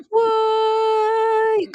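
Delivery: high, drawn-out "what"; hammed-up high-pitched incredulous cry; mock disbelief. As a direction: squeal it in exaggerated disbelief, holding the note. Retold reaction to angel voice.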